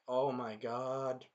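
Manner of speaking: very slow mock reaction; drawn-out dismay. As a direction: stretch every word out, acting appalled. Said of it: "And I'm like".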